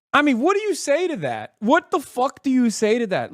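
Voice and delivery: stunned, outraged rhetorical question; exasperated disbelief. Real, not acted. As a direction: throw up your hands in disbelief. Outraged at volunteered Israel visit.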